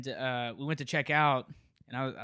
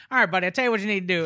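fast, loud, higher-pitched mock country guy; country father-in-law; 6 semitones higher, 7 dB louder, much faster than usual. Storyteller imitating father-in-law breaking the silence, lightly voiced country guy. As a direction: rattle it off like a helpful country guy. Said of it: "my father-in-law broke the crowd".

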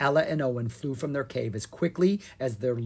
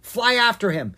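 urgent; 9 semitones higher, 7 dB louder than usual. Acted. Urgent order while fleeing.

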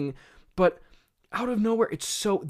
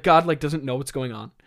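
normal talking voice

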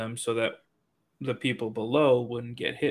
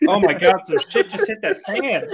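laughing shout; 4 semitones higher, 9 dB louder than usual. Real, not acted. Laughing outburst.

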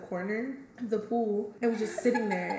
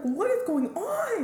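mock shocked; 11 semitones higher, slower than usual. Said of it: "and we were like".

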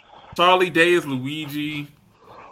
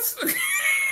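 high laugh; 8 dB quieter than usual. Real, not acted. Laughs at his own reaction.

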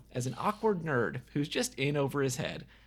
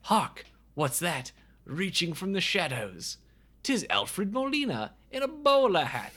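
hammed-up British accent, higher on the reveal; mock British herald; 4 semitones higher than usual. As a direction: cry out like a theatrical olde English narrator. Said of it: "Nobody in this movie has a British accent".

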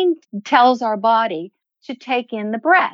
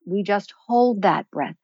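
ordinary talk

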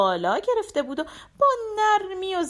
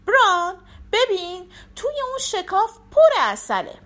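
higher, coaxing fox voice, a bit louder; sly fox luring the bear; 8 semitones higher, 3 dB louder than usual. A narrator's reading. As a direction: tempt the bear with honey. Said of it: "راینکه به خرسه گفت".

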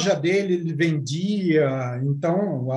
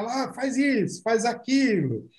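técnico gritando; 7 semitones higher than usual. Retold or imitated. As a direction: imitate a coach calling from the sideline. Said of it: "como se você fosse o técnico, gritando". (grito de técnico imitado, leve e agudo)

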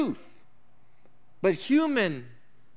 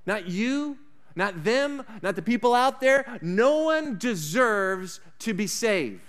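preaching; 3 semitones higher, slower than usual. Acted. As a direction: drive it home to the congregation. Preacher builds to full force.

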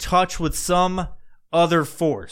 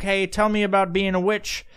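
King James; 9 semitones higher than usual. Acted. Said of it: "brought in before King James, and he did his little thing".

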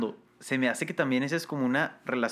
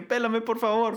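high, pleading pet voice; a high little dog voice begging to be noticed; voicing the dog; 10 semitones higher than usual. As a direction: beg for attention in a high squeaky pet voice.

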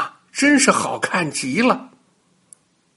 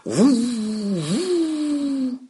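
high, long whooshing wind sound; a playful storyteller's wind gust; blowing wind noise; 9 semitones higher, 3 dB quieter than usual. Acted. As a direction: howl out like the wind. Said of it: "于是他就吹了起来".

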